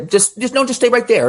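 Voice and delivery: relived urgency, voice jumping up; urgent retold command. Telling a man to stay put.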